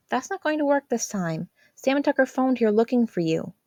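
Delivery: a hint of a worried big sister; teenage sister voice. A narrator's reading. Jazz voiced by narrator.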